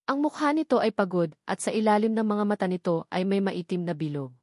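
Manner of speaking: ordinary delivery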